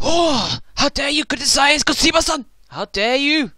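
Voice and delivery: high, loud outrage in a bad Japanese accent; gasp, then scold in a hammed-up Japanese accent; terrible mock Japanese accent. Devotee rebuking a critic of Kojima, terrible mock Japanese accent. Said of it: "with my terrible accent".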